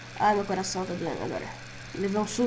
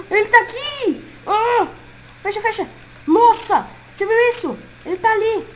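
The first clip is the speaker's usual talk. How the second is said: high, loud frantic yells; panicked screaming; 11 semitones higher, 7 dB louder than usual. Acted. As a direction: scream in terror. Fox aparece na porta. (gritando em pânico)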